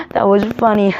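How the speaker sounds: high, loud acted boy's laughter; laughing. A narrator's reading. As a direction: say it through put-on laughter, pitch up. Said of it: "Sam just laughed".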